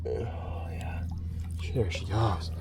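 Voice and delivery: soft whispered release; whispering. Real, not acted. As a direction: whisper as she swims away. Releasing the fish.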